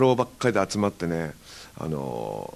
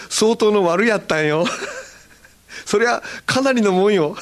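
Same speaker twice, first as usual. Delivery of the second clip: higher, laughing Hakata boast; proud Hakata boast; 8 semitones higher than usual. Retold or imitated. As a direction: show off to a friend in Hakata dialect. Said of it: "ちょっと得意げに喋っていました". (得意げな博多弁)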